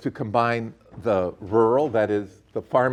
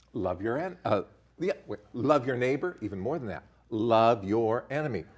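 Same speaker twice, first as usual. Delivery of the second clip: ordinary talk, nothing marked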